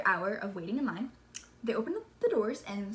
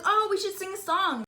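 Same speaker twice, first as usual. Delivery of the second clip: high, louder excited crowd voice; imitated eager suggestion; 10 semitones higher, 5 dB louder than usual. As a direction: pipe up eagerly, pitched high. Voices someone in waiting crowd.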